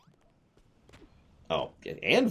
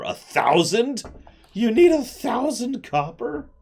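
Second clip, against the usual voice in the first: high, slow, stunned disbelief; shocked disbelief; 6 dB louder, slower than usual. Acted. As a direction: squeak it out in shock. Shocked at the huge demand.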